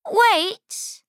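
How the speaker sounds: high, sharp goat cry; mother goat voice. Acted.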